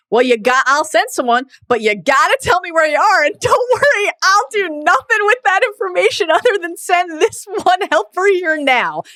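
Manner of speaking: high-pitched mock reassurance; imitated plea to chancellor. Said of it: "her saying to the chancellor".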